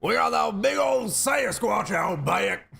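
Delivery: high, loud, slow yokel shout. Acted. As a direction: drag it out like a proud backwoods witness. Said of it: "I could do that all day".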